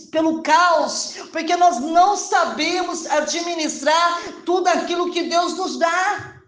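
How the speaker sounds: loud, high, ringing exhortation; full-force preaching. Real, not acted. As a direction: proclaim it to the congregation with force. (pregação inflamada, voz alta e projetada)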